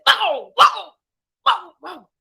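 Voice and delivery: very loud, high fierce barking. Acted. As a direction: scare the dog off with a bark. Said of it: "Mother cat fiercely says".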